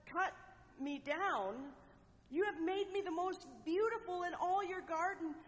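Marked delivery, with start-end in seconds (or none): 2.3-5.3 higher, pleading bamboo; a frightened plea not to be cut; trembling fear. A narrator's reading. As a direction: beg in rising panic. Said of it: "A trembling fear shook Bamboo".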